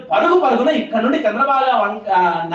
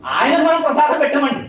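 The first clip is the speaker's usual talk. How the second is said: normal talking voice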